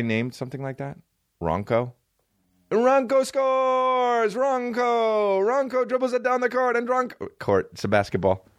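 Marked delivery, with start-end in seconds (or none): 2.7-7.3 high, slow stadium announcer call; sports commentator. Pretend. Joking about a player named Ronco, announcer yelling his name. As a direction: belt it like a play-by-play man.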